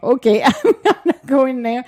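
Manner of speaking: bright laugh through the words; amused laugh at her own tech trouble. Real, not acted. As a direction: chuckle your way through it. Laughing at failing electronics.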